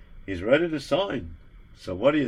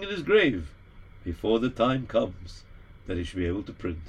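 deferential assistant; 4 semitones lower than usual. Acted. Assistant urges the Rebbe, low and deferential. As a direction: suggest it carefully, eyes down.

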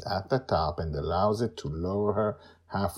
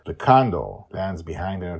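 ordinary delivery, nothing marked

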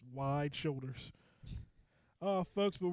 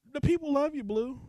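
hype-man excitement; 8 semitones higher, 5 dB louder than usual. Acted. Hyping up Blue to listeners.

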